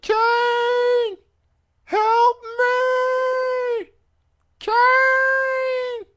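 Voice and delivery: high, loud, wailing shout for help; strained falsetto cry of a helpless manager calling for Kane; Paul Bearer impression. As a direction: screech like a desperate old man crying out. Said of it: "God, I love Paul Bearer's voice".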